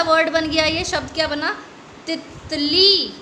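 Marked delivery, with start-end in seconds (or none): 2.1-3.1 तितली with short ति, long ली drawn out; teacher modelling तितली for children; stretched reading. Shown as a demonstration. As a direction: say तितली slowly, stretching the last ली. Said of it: "हम ऐसे ही पढ़ते हैं तितली को".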